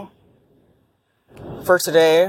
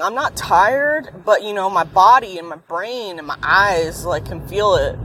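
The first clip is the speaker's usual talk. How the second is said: ordinary talk, nothing marked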